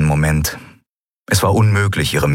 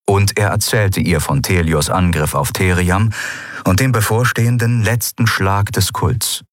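plain reading voice

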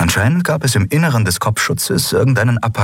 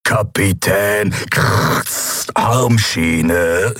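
slow mechanical demand; much slower than usual. Acted. Emperor repeating demand.

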